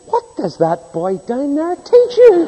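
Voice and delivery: the fed-up policeman asks in disbelief; exasperated cop. Retold or imitated. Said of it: "one cop got so exasperated".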